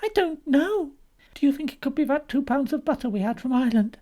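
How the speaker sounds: high-pitched worried wife; anxious Molly. A narrator's reading. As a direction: wonder nervously what the offence was.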